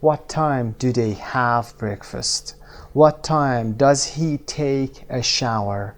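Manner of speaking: very slow, clear English model; slow model sentence. Shown as a demonstration. Teacher modelling for pairs, very slow. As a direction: spell it out slowly for students.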